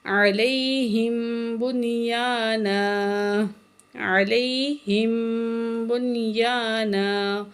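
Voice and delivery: slow, drawn-out tajweed chanting; Quran recitation. Shown as a demonstration. Teacher recites the verse for students, slow melodic chant. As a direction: recite slowly with full nasal hum.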